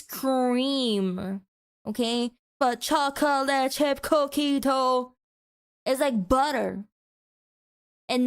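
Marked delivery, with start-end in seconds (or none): 2.8-5.1 "chocolate chip cookie dough" slow, syllable by syllable; laboured syllables. Shown as a demonstration. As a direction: make "chocolate chip cookie dough" sound like hard work. Mocking the long name.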